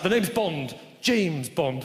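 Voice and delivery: mild Bond impression. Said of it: "why don't you just fucking say".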